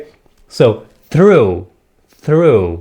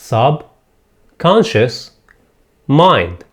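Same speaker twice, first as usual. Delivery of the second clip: "subconscious mind" in separate pieces, long pauses between; broken into parts; much slower than usual. Shown as a demonstration. Teacher dividing the word for learners, broken into parts. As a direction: say "subconscious mind" part by part: sub, conscious, mind. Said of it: "نقسم هذه الكلمة".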